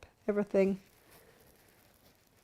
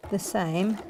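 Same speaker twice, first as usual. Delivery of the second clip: normal talking voice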